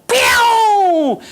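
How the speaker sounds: high, loud drawn-out launch noise; loud flying whoop. Retold or imitated. Hits a branch and flies over the bars, loud high whoop. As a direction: let out a long, high whoosh of flight. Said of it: "and I go".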